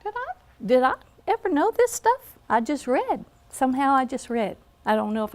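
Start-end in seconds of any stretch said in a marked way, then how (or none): 0.0-2.4 high-pitched puzzled recollection of herself; mock-bewildered questioning; bewildered self-quote. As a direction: act out being baffled, voice swooping up high. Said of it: "I was going".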